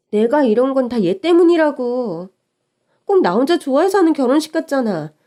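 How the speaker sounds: upset complaint. Acted. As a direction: pour out the frustration.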